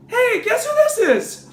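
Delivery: very high, excitable caller; Spider-Man. Acted. Spider-Man phoning someone, high-pitched playful voice. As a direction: squeal it high like an eager kid hero. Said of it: "Spider-Man makes a phone call".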